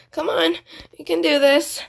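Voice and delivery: normal talking voice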